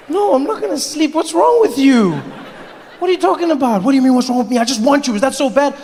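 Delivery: put-on high, pleading boyfriend whine; whiny boyfriend. Boyfriend whining to his tired girlfriend, high and pleading. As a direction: whine like a needy man begging for sex.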